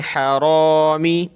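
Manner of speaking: slow chanted हरामी with a rounded "ro"; heavy raa. Shown as a demonstration. Quran teacher drilling the word, heavy raa in हरामी. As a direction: draw out a thick "raa" in हरामी. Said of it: "रा को मोटा करें".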